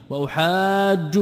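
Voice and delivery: وأحاج said slowly with the ج doubled and held; doubled jeem. Shown as a demonstration. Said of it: "بتشديد الجيم". (وأحاج بتشديد الجيم ببطء)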